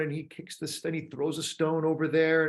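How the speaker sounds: ordinary delivery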